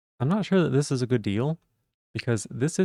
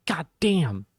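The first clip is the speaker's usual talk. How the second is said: demo exclamation; 5 semitones higher than usual. Shown as a demonstration. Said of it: "it's an exclamation, like".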